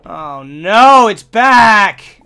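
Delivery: loud, rising oh no; loud exasperated cry; dismayed cry. Real, not acted. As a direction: wail it in disbelief. Kitchen bug returns.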